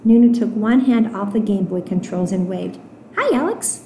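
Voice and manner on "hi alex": put-on high, small child voice; little girl's greeting. Nunu waving to Alex from the pipe, childlike greeting.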